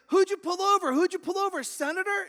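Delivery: very high, loud mock hysteria; frantic dispatcher. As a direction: shout questions in a hammed-up squeal. Dispatcher more frantic each round.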